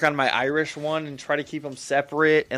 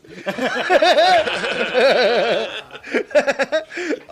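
long, loud, high helpless laugh; long high laughter; 10 semitones higher, 6 dB louder than usual. Real, not acted. Guest still laughing at the hosts, long and high. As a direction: keep laughing high and free.

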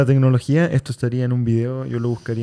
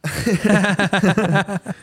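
laugh; 7 semitones higher than usual. Real, not acted. Laugh after a dark remark.

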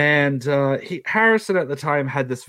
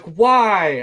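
calling back; 8 semitones higher, 6 dB louder than usual. Retold or imitated. Yelling back.

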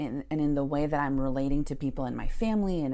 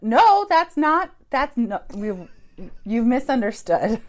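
high, loud, slow sputtering denial; much louder, higher startled no; flustered protest; 6 semitones higher, 9 dB louder than usual. Retold or imitated. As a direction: backpedal in laughing panic.

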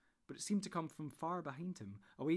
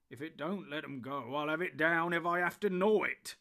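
building to a loud, high threat; fierce; 3 semitones higher, 9 dB louder than usual. A narrator's reading. Sam threatening the tree, building fiercely. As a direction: start firm, swell into a fierce vow. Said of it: "said Sam fiercely".

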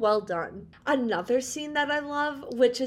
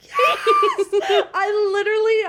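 squealing yes with a laugh, much higher and louder; excited shout; 9 semitones higher, 8 dB louder than usual. Real, not acted. Friend squeals about her favorite scene, laughing loudly. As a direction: burst out laughing with delight.

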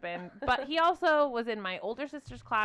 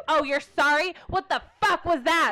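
mock angry; 6 semitones higher, 7 dB louder than usual. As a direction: fake outrage, louder and higher. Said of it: "I act all angry".

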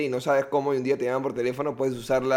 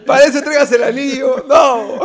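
laughing joke; 9 semitones higher, 9 dB louder than usual. Acted. Joking line about the ring.

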